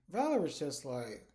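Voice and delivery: normal talking voice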